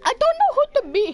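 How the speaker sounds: high, loud and fast; squeaky outburst. Real, not acted. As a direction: blurt it out in a squeak.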